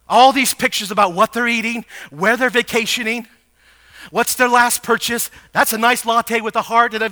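insistent pulpit catalogue; preaching list. Acted. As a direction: rattle off the items on one high note.

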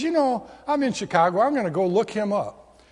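light up with the sudden idea; excited idea. Retold or imitated. Roger finds the business card.

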